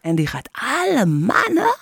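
slow put-on Tukker accent; imitated Tukker voice. Co-host mocking a TV contestant to colleague, Tukker accent. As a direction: mimic a Tukker's little voice, drawn out. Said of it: "dat stemmetje nadoen".

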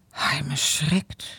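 plain reading voice

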